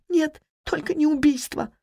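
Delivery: high, tearful denial; through tears. A narrator's reading. Wife rejects the word murder, speaking through tears. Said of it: "сквозь слёзы проговорила она".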